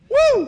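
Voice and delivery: loud, high preacher whoop; joyful whoop. Real, not acted. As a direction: whoop to lift the congregation. Preacher celebrating dad's salvation.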